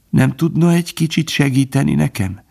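high, feeble plea for help; a dying hare's plea; pleading plea. A narrator's reading. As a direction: ask weakly, wounded. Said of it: "kérdezte".

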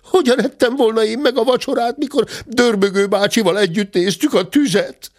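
very high, rapid cub protest; indignant little cub's screech; screeching bear cub. Acted. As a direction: rattle out the denial in a high squeal. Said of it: "rikácsolta a bocs".

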